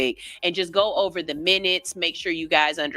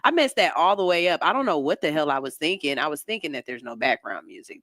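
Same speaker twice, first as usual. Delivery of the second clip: usual speaking voice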